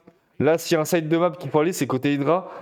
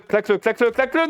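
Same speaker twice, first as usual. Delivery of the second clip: de plus en plus haut, pressant; encouragement pressant; 8 semitones higher, faster than usual. Real, not acted. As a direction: pousse le joueur à finir le kill. Urging player to finish kill. (le caster supplie le joueur de conclure)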